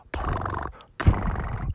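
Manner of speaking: breathy voiceless purr; soft whispered cat purr; mock purr. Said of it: "rub up against 'em and go".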